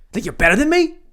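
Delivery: high, loud mock-aggressive challenge; hammed-up indignant yell; mock confrontation. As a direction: pick a pretend fight, voice shooting up.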